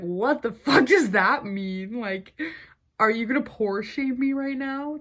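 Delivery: stunned disbelief. Retold or imitated. Retelling a salesman's odd compliment, stunned and incredulous. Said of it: "in my head, I was like".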